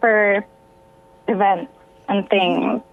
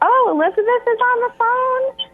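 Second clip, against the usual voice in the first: much higher, bright imitation of an excited younger sister; sister's cheerful voice; 13 semitones higher than usual. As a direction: squeal it like the sister. Sister voiced greeting on phone.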